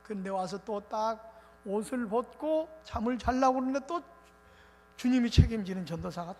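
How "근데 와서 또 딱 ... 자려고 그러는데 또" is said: ordinary talk, nothing marked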